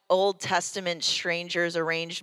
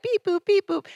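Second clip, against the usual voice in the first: mock robotic beeps; 12 semitones higher, 4 dB louder than usual. Mocking dull Bible begats.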